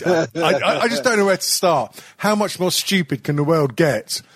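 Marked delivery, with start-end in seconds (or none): none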